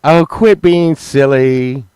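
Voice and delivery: high, loud, slowed brush-off; dismissive wife. Retold or imitated. Imitating Sunshine brushing off his injury, high and slow. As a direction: wave it away like it's nothing.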